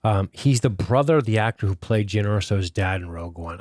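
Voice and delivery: ordinary talk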